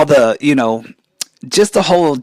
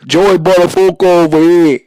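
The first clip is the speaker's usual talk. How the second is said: loud schoolyard New York catchphrase; New York accent impression; 6 semitones higher, 9 dB louder than usual. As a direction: shout it like kids mocking in the hallway. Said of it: "run around school and say".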